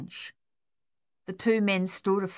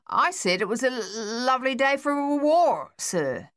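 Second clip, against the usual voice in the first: stammering answer; 7 semitones higher, 4 dB louder than usual. Acted. Stammering soldier answering captain.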